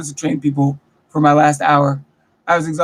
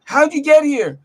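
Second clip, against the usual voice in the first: mock guard yelling; 6 semitones higher than usual. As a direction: ham up the angry guard barking at you. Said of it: "starts yelling at me like".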